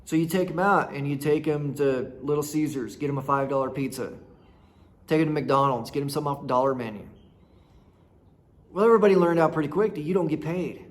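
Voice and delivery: ordinary delivery, nothing marked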